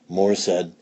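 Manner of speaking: usual speaking voice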